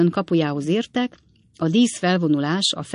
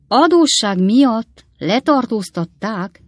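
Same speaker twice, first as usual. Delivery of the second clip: slow, loud and higher in disbelief; shocked exclamation; 6 semitones higher, 7 dB louder, slower than usual. A narrator's reading. Pickwick hears Sam was arrested, crying out aghast. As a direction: cry out in shock. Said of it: "kiáltott fel Pickwick úr".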